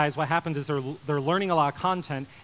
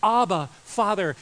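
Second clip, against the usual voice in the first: slow, tender Abba Father, voiced high and louder; Jesus praying; 5 semitones higher, 6 dB louder, slower than usual. Jesus teaching disciples to pray, tender higher call. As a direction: lift it up like a child calling his father. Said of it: "And he said".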